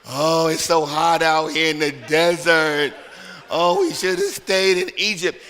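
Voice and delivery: loud, slow, drawn-out moaning about food and water; mock grumbling wanderers, hammed-up and loud; whiny complaining Israelites. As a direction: whine it like a crowd that never stops griping. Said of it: "Constantly complaining".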